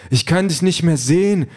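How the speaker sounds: high-pitched worried AI companion; alarmed digital boyfriend. A narrator's reading. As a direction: call out anxiously, high. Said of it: "sagt die Figur auf dem Monitor".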